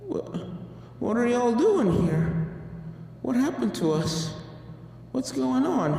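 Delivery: stunned and lost, asking quietly; bewildered. Acted. Applejack asks her returned friends, hushed and bewildered. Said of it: "Applejack blinked".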